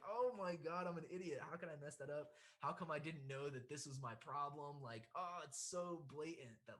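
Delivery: high, exasperated self-scolding; retold self-directed anger. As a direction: act out kicking yourself over a mistake. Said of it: "I was, like, so mad".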